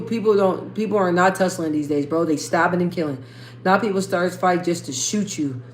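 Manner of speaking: ordinary talk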